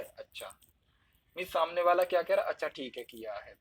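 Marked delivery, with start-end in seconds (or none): 0.0-0.6 low, soft, brief acknowledgement; accepting अच्छा. Shown as a demonstration. Listener accepting that the work is done, low matter-of-fact अच्छा. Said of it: "जैसे स्वीकृति में". 2.5-3.5 low, soft okay; acceptance. Shown as a demonstration. Listener told the work is done, quietly accepting. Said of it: "जैसे स्वीकृति में".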